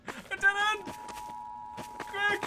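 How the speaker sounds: high frantic cries; panicked shouting. Real, not acted. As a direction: scream to flee. Trapped, fleeing monster.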